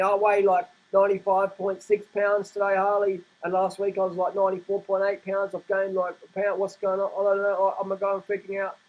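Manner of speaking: lightly voiced nervous scale-watcher; a hint of mocking panic; mock worried dieter. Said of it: "They were saying".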